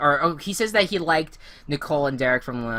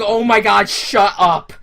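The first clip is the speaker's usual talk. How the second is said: exasperated; 6 semitones higher, 10 dB louder, slower than usual. Retold or imitated. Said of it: "I'm just like".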